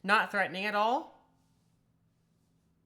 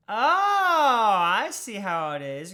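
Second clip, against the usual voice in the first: mock offended; 9 dB louder, much slower than usual. Playful mock indignation.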